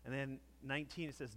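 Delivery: ordinary talk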